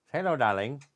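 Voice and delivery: normal talking voice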